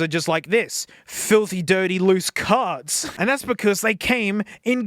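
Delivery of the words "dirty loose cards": ordinary talk, nothing marked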